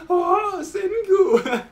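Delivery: very high, loud wail through laughter; hammed-up laughing sob; laugh-crying. Taiju laugh-crying at Senku, hammed-up. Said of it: "Taiju's just crying and laughing".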